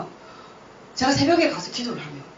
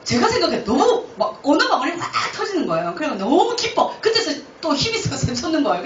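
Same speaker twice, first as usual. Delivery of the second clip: joyful excitement; 6 semitones higher, 3 dB louder than usual. Real, not acted. Joy at receiving tongues.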